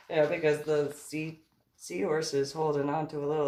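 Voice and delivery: usual speaking voice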